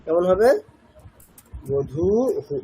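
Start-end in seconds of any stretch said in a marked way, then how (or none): 1.7-2.6 "বধূ হু" with long "ধূ", short "হু"; visarga as hu. Shown as a demonstration. Teacher showing visarga after long u, "বধূ হু" ending in short হু. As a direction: hold "ধূ", add a brief "হু". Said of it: "এরকম".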